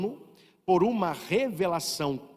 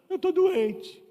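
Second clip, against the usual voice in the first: mock sick whine; 12 semitones higher, much slower than usual. Mocking a sick person crying.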